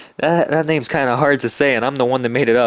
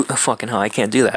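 ordinary talk

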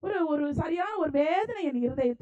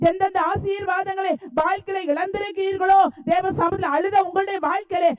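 impassioned preaching; 4 dB louder than usual. Real, not acted. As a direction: preach it forcefully, ringing out each phrase. Preaches with full force.

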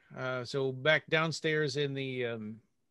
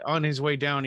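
ordinary talk, nothing marked

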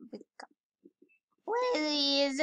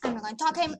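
ordinary delivery

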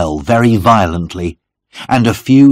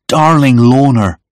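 impassioned cry, higher and louder; 5 semitones higher, 5 dB louder than usual. A narrator's reading. John to Lorna as he holds her close, crying out. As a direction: call out to a beloved. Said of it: "I cried".

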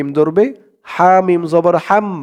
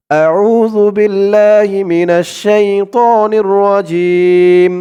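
slow, drawn-out Arabic recitation, louder; 3 semitones higher, 5 dB louder, much slower than usual. Shown as a demonstration. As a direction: recite it slowly like in prayer. Recites the taawwudh slowly.

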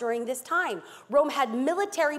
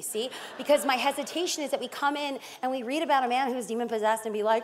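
ordinary delivery

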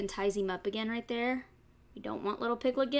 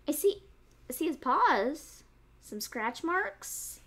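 usual speaking voice

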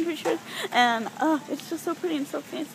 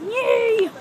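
high, loud, gleeful yay; excited cheer; 11 semitones higher, 11 dB louder than usual. Real, not acted. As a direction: cheer for ice cream at full volume. Cheering about getting ice cream.

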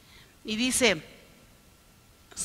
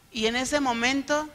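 normal talking voice

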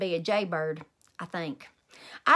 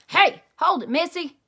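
grouchy yell; 6 semitones higher, 8 dB louder than usual. A narrator's reading. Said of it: "it yelled".

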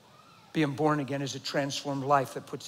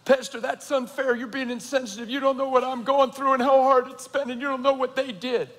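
higher, whiny mock protest of a hurt member; complaining churchgoer; 8 semitones higher, 3 dB louder than usual. Pastor mimicking a hurt churchgoer's protest, higher and whiny. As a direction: imitate a wounded believer pleading their case.

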